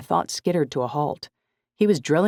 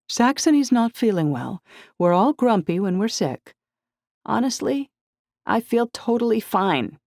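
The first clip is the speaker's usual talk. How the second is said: mother voice; 8 semitones higher, 4 dB louder than usual. A narrator's reading. Mother's voice, then Saxony.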